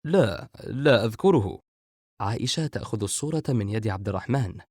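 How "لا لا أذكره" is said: slow, mildly surprised denial; understated narrated astonishment; puzzled. A narrator's reading. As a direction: draw it out with a touch of bafflement. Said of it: "بنبرة استغراب".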